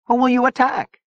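very high, strained question; tense. A narrator's reading. Gomi Boy challenging Zona's plan, tense. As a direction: challenge with tension lifting the voice. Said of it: "his voice betrayed his tension".

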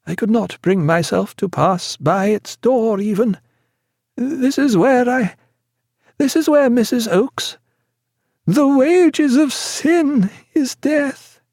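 high, slow feverish prayer; plead, then drift into prayer; terrified patient begging not to be left; delirious pleading. A narrator's reading.

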